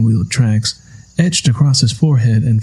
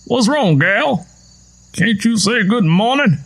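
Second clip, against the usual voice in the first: put-on higher old man voice, teasing; teasing old farmer; 10 semitones higher than usual. A narrator's reading. Tom chiding Lenny for no greeting, teasing old farmer voice. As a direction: needle her like a gruff old farmer.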